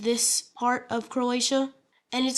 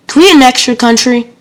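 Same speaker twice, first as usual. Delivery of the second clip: blurted out much louder, flat and blunt; mock deadpan demand; 17 dB louder than usual. Red team leader demands the gold team's country, loud and blunt. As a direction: bark the question out loud and blunt. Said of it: "deadpan it".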